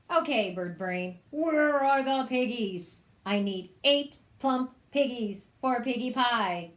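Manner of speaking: loud, bossy witch demand; bully the chicken for pigs. Acted. Witch demanding pigs from the chicken, loud.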